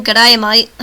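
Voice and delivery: loud, hammy Australian greeting; lightly put-on Australian twang; bad Australian accent. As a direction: attempt a terrible Australian accent. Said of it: "My accents are terrible".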